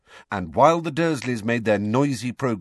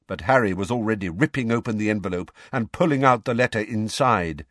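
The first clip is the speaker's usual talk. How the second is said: ordinary delivery, nothing marked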